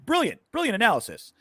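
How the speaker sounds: high-pitched mock admiration; sarcastically impressed; sarcastic praise. Real, not acted. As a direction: praise it as if it were genius, mockingly. Sarcastic praise of analysts' obvious advice.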